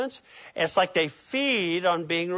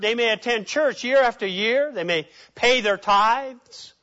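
usual speaking voice

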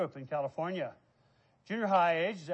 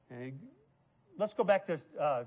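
ordinary talk

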